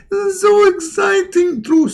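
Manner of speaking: high squeaky devil voice gloating; gleeful Shaitan. Retold or imitated. Shaitan delighting inside your mouth, high squeaky glee. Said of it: "and he is like".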